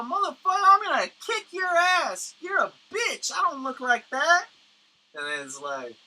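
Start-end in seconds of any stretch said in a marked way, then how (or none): 0.0-4.5 much higher, louder threatening yell; furious mock rant. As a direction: blow up at the artist. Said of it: "And then you go".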